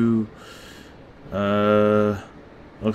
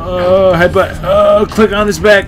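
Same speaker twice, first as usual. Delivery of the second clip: loud rushed fight calls; frantic excitement; 7 semitones higher, 4 dB louder than usual. Real, not acted. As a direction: call out the moves frantically. Frantic button prompts in fight.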